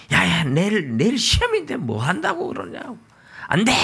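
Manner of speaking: high, shrill-free motherly scold, rising in pitch; a mother fed up with her kid; exasperated mom scolding. Retold or imitated. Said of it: "엄마가 막 속이 뒤집혀지는 거지".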